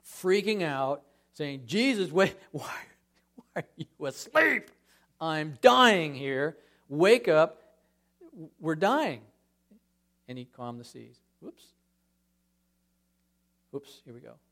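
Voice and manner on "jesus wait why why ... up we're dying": frantic plea, louder on "Wake up"; panicked disciples. Acted. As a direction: act out terrified sailors shaking Jesus awake.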